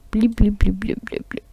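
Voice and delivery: little bloops fading away; soft bubbly bloops, trailing into near silence; shrinking sound effect. Acted. Storyteller shows him shrinking as he vomits, shrinking noise.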